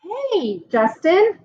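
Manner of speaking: high call across the playground. Acted. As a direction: call his name across the yard. Emilio calls out across playground.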